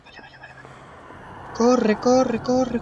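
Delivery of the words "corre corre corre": high, loud urgent "corre"; shout. Real, not acted. Gamer fleeing the creature, shouting high and loud. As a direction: yell at yourself to run.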